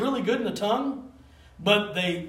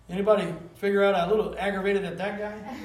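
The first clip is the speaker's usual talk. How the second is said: ordinary talk, nothing marked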